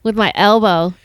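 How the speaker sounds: ordinary talk, nothing marked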